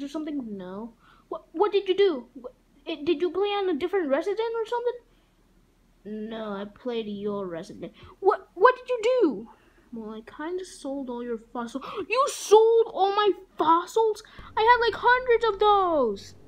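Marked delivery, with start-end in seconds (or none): 1.3-5.0 alarmed shout. Acted. Link alarmed about his game. 8.2-9.5 shouting demand. Acted. Link demanding answers. 12.1-16.3 outraged gasp and yell. Acted. Fossils sold without asking.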